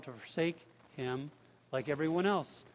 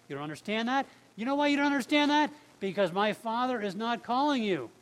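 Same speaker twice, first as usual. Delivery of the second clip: high, raised, forceful challenge; confrontational; 6 semitones higher, 5 dB louder than usual. Acted. Jesus confronting the grumblers over his hard teaching, confrontational. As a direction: press the challenge loudly, pitch pushed up.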